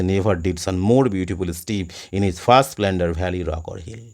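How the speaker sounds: slow, measured reading of the verse; poem recitation. Shown as a demonstration. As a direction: read the line out slowly like a poem. Reading poem lines aloud.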